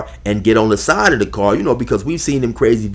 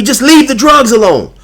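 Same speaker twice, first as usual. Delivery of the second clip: much higher and louder put-on voice on leave the drugs alone; whiny mocking imitation; 10 semitones higher, 7 dB louder than usual. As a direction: sneer it like the rival, high and loud. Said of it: "popping off at the pie hole".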